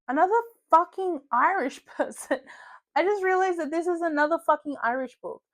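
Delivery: high, loud incredulous outburst; amused exasperation. Real, not acted. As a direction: burst out in laughing disbelief. Exasperated laughing discovery about the list.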